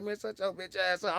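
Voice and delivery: high, testy comeback; retold irritation. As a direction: act out snapping at a laughing friend. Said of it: "I'm mad".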